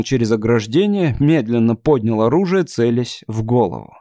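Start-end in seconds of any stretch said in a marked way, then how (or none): none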